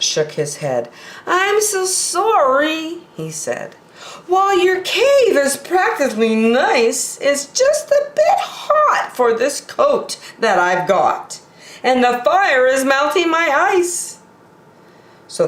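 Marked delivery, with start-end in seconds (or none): 1.3-3.1 apologetic polar bear, much higher voice; a sorry polar bear pitched well up. Acted. Polar bear caught on the line apologizes, pitched high. 4.3-14.2 high, polite polar bear turning down the cave. Acted. Polar bear politely turns down the warm cave, high-pitched.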